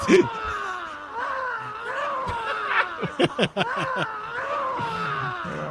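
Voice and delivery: high wheezing laughter; real laughing fit; long helpless laugh. Real, not acted. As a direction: crack up completely and keep going. Cracking up after the accent bit.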